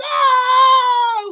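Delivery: loud, high mock shriek; final girl screaming in horror; imitated scream. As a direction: let out a hammed-up horror scream.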